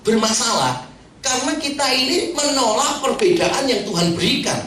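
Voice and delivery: ordinary talk, nothing marked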